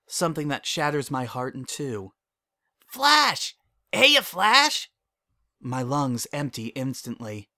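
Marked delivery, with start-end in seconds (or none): 2.9-4.9 put-on child calling out. Child calls out across playground.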